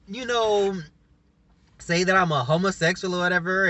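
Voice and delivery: ordinary talk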